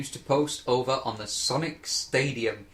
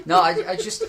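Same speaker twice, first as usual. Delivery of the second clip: high, loud, laughing; 10 semitones higher, 6 dB louder than usual. Real, not acted. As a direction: talk through a laugh. Laugh heard.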